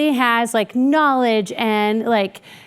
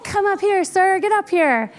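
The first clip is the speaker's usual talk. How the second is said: excited call; 6 semitones higher than usual. Retold or imitated. Eager to call colleague up.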